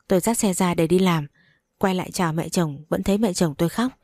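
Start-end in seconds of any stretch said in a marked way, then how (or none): none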